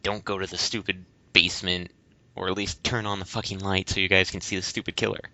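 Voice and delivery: ordinary talk, nothing marked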